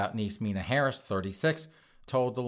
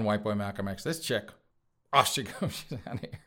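normal talking voice

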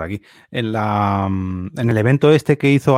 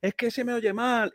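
higher, softer mock caller voice; lightly put-on voice of a colleague complaining; mock the caller's complaint, pitched up and soft; imitating a caller; 11 semitones higher, 7 dB quieter than usual. Retelling a caller at work.

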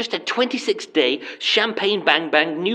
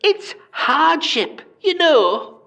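slow, higher, sorry-for-myself whine; mock self-pity; 8 semitones higher, much slower than usual. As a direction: ham up the hardship. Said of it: "Let me just tell you".

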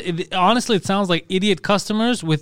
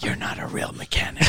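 exaggerated whispered insult; hammed-up whispering, no voice at all; mock whisper; 8 dB quieter than usual. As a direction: imitate someone yelling in a whisper. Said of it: "She started whispering".

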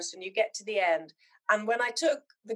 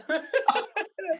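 higher-pitched amused laugh; 8 semitones higher than usual. Real, not acted. Joking about keeping it from her daughters, laughing.

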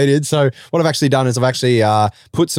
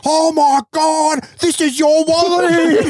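very high, slow squeal of disbelief; hammed-up shriek of realisation, words stretched out; mock shocked discovery; much slower than usual. Acts out friend discovering the wallet.

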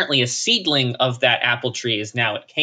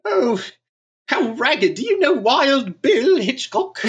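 much higher, slow, pitying posh voice; snooty British toff; 13 semitones higher, slower than usual. Pretend. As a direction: fuss over the ragged Texan like a lady. Snooty toff impression continues.

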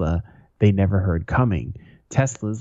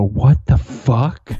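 drawn-out, louder shocked outburst; 4 semitones higher, 6 dB louder, much slower than usual. Retold or imitated. Newcomer reacting to a Confederate bumper sticker, shocked. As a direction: stretch it out high in disbelief. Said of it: "I was so shocked".

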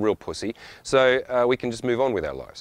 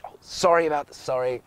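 higher, breath-light polite apology; apologetically polite; 5 semitones higher than usual. Acted. Late traveller squeezing past a family, meekly apologetic. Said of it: "there's politer ways I could do that".

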